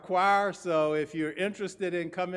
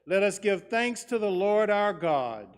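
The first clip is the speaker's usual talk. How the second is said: cathedral echo; 3 semitones higher than usual. Acted. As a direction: ring it out across the church. Liturgy intoned to congregation.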